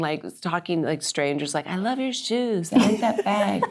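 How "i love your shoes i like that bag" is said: spacey and dazed. Retold or imitated. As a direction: chat to strangers as if in an altered state. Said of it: "like, really spacey".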